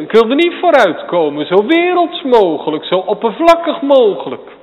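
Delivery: high, loud and fast, hammering each phrase; fiery preaching. Acted. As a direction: preach it out with full force. Preacher at full force.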